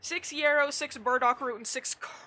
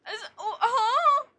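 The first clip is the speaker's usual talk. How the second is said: high, loud indignant yell; hammed-up exasperated shout; playful furious protest; mock outrage; 14 dB louder than usual. Outraged retort about accent.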